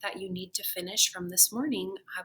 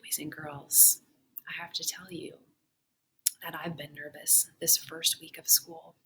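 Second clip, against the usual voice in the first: sincere confession; 4 semitones lower, 6 dB quieter than usual. Real, not acted. Teacher confessing her nerves to her pupils, soft and sincere. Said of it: "teacher confides to class".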